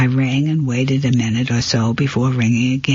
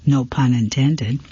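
usual speaking voice

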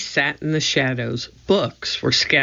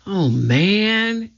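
higher, mildly amazed exclamation; awed exclamation; 6 semitones higher than usual. A narrator's reading. Jack seeing the treehouse above, mildly awed. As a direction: react in mild wonder, pitch lifted. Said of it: "he breathed".

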